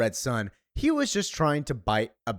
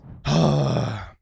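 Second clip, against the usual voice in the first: long weary sigh; heavy sigh; 3 semitones higher, 4 dB louder than usual. Retold or imitated. Supergirl about to turn Ruthie down, weary sigh. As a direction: let out a tired sigh before answering. Supergirl sighing before reply.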